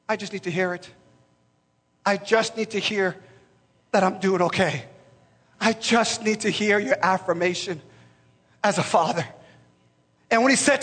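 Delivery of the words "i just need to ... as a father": much softer, lower pleading; vulnerable plea. Retold or imitated. Son begging his father for affirmation, soft and aching. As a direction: say it small, aching for approval.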